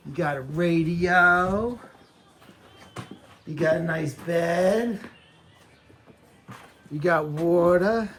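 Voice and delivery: excited pet talk. Real, not acted. Slowly telling the pigs about their new pen.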